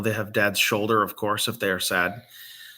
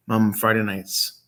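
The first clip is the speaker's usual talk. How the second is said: usual speaking voice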